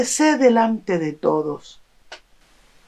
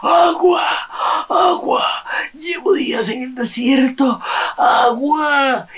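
much higher, desperate parched croak; dying of thirst; 6 semitones higher than usual. Acted. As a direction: beg for water like a man dying of thirst. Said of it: "aparece un hombre muerto de sed". (hombre muerto de sed suplicando agua)

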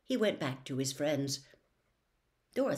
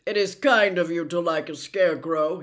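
Scarecrow voice; 9 dB louder than usual. Acted. Scarecrow telling his friends he'll get brains, loud and eager. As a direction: crow it out like a jubilant scarecrow.

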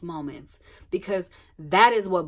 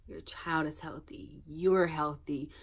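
self-soothing; 3 semitones lower, 8 dB quieter, slower than usual. Retold or imitated. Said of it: "pulling myself in, like".

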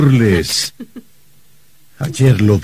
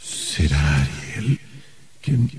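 horrified hush; 12 dB quieter, slower than usual. Acted. Horrified realization.

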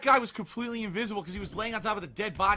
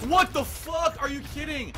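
high-pitched angry outburst; real fury at the game; outraged disbelief; 8 semitones higher, 4 dB louder than usual. Real, not acted. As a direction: cry out loud, then ease the volume. Player killed despite firing first.

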